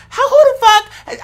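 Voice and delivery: high, slow, incredulous cry; shocked, as if seeing it first; stunned disbelief. Retold or imitated. Imagining first hearing of a farting skunk, high and incredulous. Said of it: "I would think".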